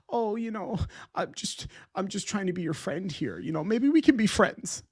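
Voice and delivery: soft, breathy, wheedling mimic of a rival pretending to be a pal; mocking fake friendliness. Streamer mocking a rival's friendly overture, soft, sugary imitation. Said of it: "be like".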